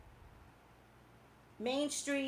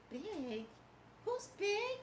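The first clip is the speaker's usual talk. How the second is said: soft retold bafflement, leaping high; mock-baffled high questioning; confused; 10 semitones higher, 6 dB quieter, much slower than usual. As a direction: act puzzled, pitch climbing sharply on the question. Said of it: "I was confused".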